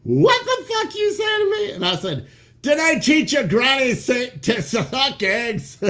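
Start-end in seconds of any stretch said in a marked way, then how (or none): 0.0-1.7 much higher, loud mock shout from the wife; wife yelling back. Wife answering his odd Scottish yell, high mock shout. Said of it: "She was like". 2.6-5.8 yelled saying. Retold or imitated. Yelling a Scottish saying at his wife, high and laughing. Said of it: "I yelled it at my wife".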